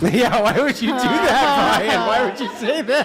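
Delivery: high, slow laughing disbelief. Real, not acted. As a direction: laugh through the question.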